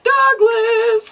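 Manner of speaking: high excited shout of a name. Real, not acted. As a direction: call it out high and loud. Calling out a character name.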